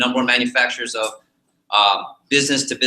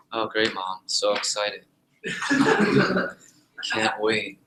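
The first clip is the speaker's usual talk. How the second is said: low, soft sarcastic reply; sarcastic unexcited; 4 semitones lower, 5 dB quieter, slower than usual. Retold or imitated. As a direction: flatly fake enthusiasm at mom's news. Unenthusiastic kid replies to mom.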